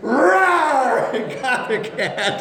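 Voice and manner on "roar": loud, high imitated dog roar; big mock attack growl; dog's roar. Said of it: "And the dog went".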